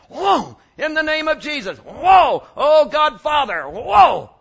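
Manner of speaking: shouted prayer, each word banged out; hammed-up yelling prayer with big whoas; loud mock pounding prayer. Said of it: "every third word, hit that chair".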